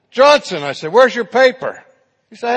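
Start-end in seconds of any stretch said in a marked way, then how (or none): 0.1-0.6 loud, high roll-call summons. Acted. Professor singling out a student, calling out. 0.9-1.8 loud, high professor's challenge; demanding. Acted. Professor confronting Johnson over his paper, demanding.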